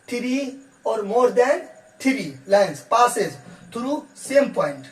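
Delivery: very slow, word by word, as if for note-taking; slow dictation. Shown as a demonstration. Teacher dictating the English definition to students, very slow. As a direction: dictate it so students can write every word.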